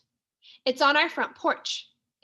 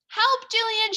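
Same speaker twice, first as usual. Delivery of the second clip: calling for help; 9 semitones higher than usual. A narrator's reading. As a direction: shout for a friend down the street. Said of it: "she screams".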